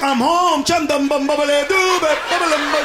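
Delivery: high, loud mock chant; mock homecoming gibberish. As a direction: chant it like coming home. Imagined flashback gibberish.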